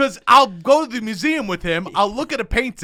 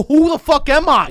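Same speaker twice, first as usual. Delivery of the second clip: louder, higher anguished cry of an artist; 8 semitones higher, 9 dB louder than usual. Retold or imitated. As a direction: shout it like Van Gogh losing himself. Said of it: "'cause you go".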